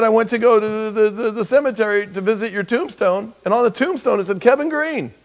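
high, loud, half-laughing retelling; wife recounting the tombstone dream, giggling. Retold or imitated. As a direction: tell it while cracking up. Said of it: "she said".

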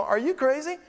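very high, loud, incredulous cry; exasperated outburst. Acted. Pastor answering people's excuses for skipping church, exasperated outburst.

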